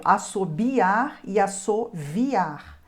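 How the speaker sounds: assobiar e assoviar, slowly and clearly; slow double spelling. Shown as a demonstration. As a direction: say both spellings deliberately slowly. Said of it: "dupla grafia". (pronúncia lenta das duas grafias)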